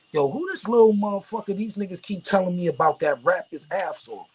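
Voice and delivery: a lightly voiced record boss sizing up a kid; imitating the boss. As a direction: play the swaggering boss walking in. Gangster boss walks into studio.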